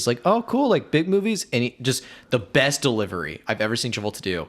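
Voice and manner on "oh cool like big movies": high, bubbly, impressed question; eager girl voice. Retold or imitated. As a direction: gush like an excited fan. Imitating her eager question.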